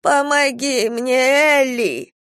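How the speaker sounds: put-on slow, feeble old woman's plea; weak cry for help. A narrator's reading. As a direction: call out weakly, pleading. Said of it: "раздался слабый крик бабушки".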